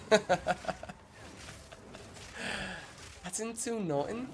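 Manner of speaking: very high-pitched mock Scouse; butchered Scouse accent. Streamer imitating his date's Scouse phrase, butchered accent. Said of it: "I'll do a butchered version".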